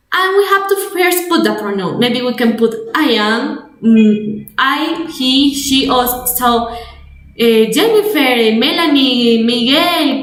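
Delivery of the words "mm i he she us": normal talking voice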